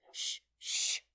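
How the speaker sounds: forceful, sharp shushing; loud hissed shh; hushing. A narrator's reading. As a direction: hush someone insistently. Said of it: "the Hatter and the March Hare went on".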